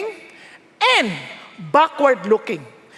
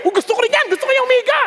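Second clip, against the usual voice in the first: high-pitched, eager wanting; excited admiration; 8 semitones higher, much faster than usual. Retold or imitated. Preacher seeing a pastor lie down, excited admiration. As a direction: gush with rising pitch. Acts his awe at pastor.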